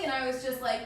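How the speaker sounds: normal talking voice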